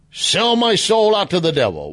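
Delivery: high, theatrical mock declaration; mock-dramatic vow. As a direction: belt it out like a hammy big confession.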